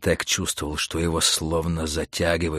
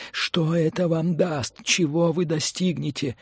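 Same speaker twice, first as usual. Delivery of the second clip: thin, high, slow pleading; piercing gnat-like voice; 8 semitones higher than usual. Acted. Mentor questions Montag through the earpiece, piercingly. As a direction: whine high like a gnat in the ear. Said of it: "пронзительно звенело в ухе мошка".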